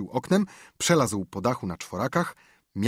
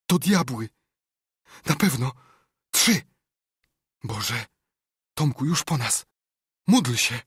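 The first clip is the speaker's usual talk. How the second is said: high, forceful, terrified outbursts; horrified whisper; 5 semitones higher, 6 dB louder than usual. A narrator's reading. Huck seeing lantern-carrying devils approach, horrified urgency. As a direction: gasp out short phrases in terror, with pauses. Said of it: "Huck wyszeptał z przerażeniem".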